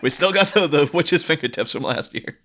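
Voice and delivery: imitated exasperated mom. Mom refusing her kid a new costume, mock exasperation. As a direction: laughingly brush the kid off as mom.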